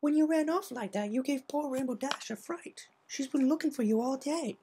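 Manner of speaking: soft tender Rarity; warm Rarity. Acted. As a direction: soothe the crying filly. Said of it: "smiled warmly".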